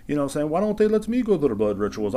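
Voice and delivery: high, loud mock wife voice; imitated wife. Host imitating the prince's wife asking about blood rituals, high mock wife voice. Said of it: "I don't think she talks like that either".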